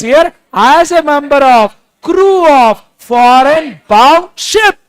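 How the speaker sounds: loud, slow, drawn-out recital; emphatic revision dictation. Real, not acted. Teacher revising two points with students, loud slow dictation. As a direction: chant out each phrase loudly for the class.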